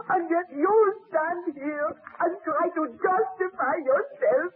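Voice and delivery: normal talking voice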